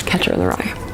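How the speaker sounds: embarrassed mutter. Real, not acted. Sheepish aside on the quote.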